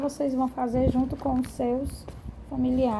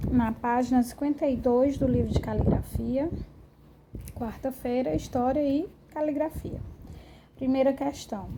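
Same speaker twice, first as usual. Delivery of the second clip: ordinary delivery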